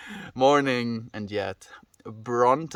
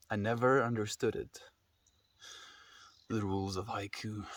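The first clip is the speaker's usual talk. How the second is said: dramatic poetry reading; 12 dB quieter than usual. Shown as a demonstration. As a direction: read it like a solemn poem with long pauses. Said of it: "Extra long pause".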